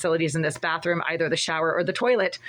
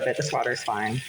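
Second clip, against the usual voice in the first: sarcastic; 8 semitones lower than usual. Real, not acted. Sarcastic claim water is fine.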